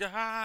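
high, loud falsetto "ah"; imitated helpless shriek of mom; mock scream. Imitating mom standing there screaming.

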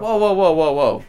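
high, loud whoa whoa; loud protest. Real, not acted. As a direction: shout them down in protest. Protesting a rival's quiz answer.

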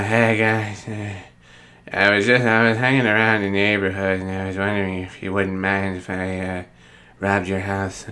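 soft, low, mumbly Adam Sandler voice, lightly done; a hint of Sandler asking nicely; Adam Sandler impression. Pitching a movie to a friend, a soft Sandler voice. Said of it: "attempt at an impression of Adam Sandler".

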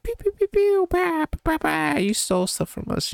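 high squeaky "pew pew" blaster noises; imitated gunfire. Bad guys attack Doc, squeaky mock gunfire.

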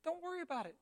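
soft, high soothing voice; acted unruffled comfort; calm reassurance. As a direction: gently wave the worry away. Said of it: "And he says".

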